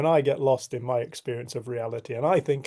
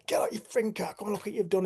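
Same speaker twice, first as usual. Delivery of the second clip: livid; 7 semitones higher, faster than usual. Retold or imitated. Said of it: "I was absolutely livid".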